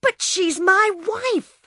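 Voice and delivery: high, loud, slow protest; angry. Acted. Bill protesting to the mother, angry and high. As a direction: cry out in hurt anger. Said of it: "Bill's face was red and angry".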